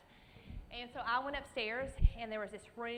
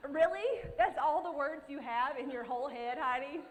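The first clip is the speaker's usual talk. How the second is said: self-mocking; 4 semitones higher, 7 dB louder than usual. Real, not acted. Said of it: "mocking herself".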